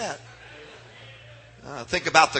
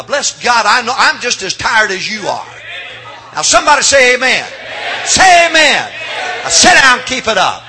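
loud, higher calls to the congregation; rousing shout; 6 semitones higher, 8 dB louder than usual. Real, not acted. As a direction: wake them up, louder with each call.

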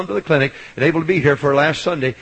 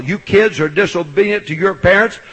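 ordinary delivery

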